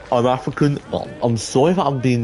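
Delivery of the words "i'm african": ordinary talk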